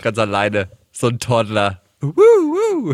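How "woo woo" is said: high, loud mock whoop; playful cheer. Host joking about a toddler moving alone, high mock whoop.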